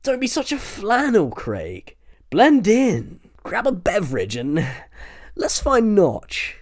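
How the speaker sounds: high, bossy and slow at the end; lightly voiced bossy teen; brisk Milton. A narrator's reading. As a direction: tell him to pull himself together. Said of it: "Milton to Craig".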